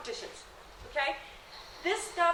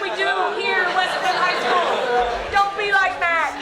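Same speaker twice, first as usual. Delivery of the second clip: loud teasing; 3 semitones higher, 9 dB louder, slower than usual. Real, not acted. Teasing a student loudly.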